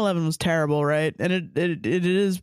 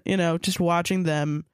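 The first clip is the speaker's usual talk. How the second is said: ordinary delivery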